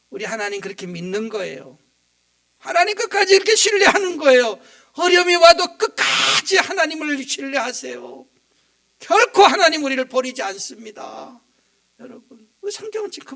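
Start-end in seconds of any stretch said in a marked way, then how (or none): 2.6-11.4 loud, impassioned sermon peak; full-force preaching. Real, not acted. Pastor urging the church to trust God, loud and impassioned. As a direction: drive the point home to the congregation.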